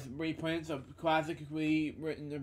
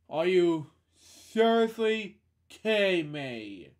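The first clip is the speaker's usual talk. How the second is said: slow, louder incredulous complaint; exasperated disbelief; 3 semitones higher, 6 dB louder, slower than usual. Real, not acted. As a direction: drag it out in fed-up disbelief. Exasperated outburst while reading.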